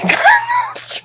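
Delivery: high shrieking burst of laughter; a squealing laugh that breaks the reading. Real, not acted. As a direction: crack up helplessly mid-sentence.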